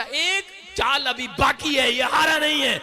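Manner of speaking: retold excited shouting. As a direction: imitate an insistent cry, high and drawn out. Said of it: "उसको देखकर चिल्लाने लगा".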